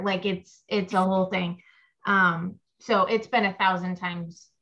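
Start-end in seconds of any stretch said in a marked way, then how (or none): none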